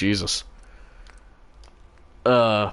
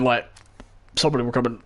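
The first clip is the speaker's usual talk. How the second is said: ordinary talk, nothing marked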